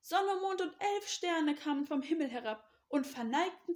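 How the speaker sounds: high, excited storytelling voice; excited calling. A narrator's reading. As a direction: announce a wondrous dream. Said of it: "rief er".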